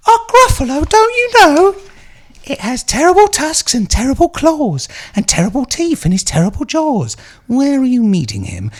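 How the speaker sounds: high, creepy little mouse voice. Acted. Reading to a friend, a high creepy mouse voice. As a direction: make the mouse sound sly and a bit sinister. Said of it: "because he talks like that".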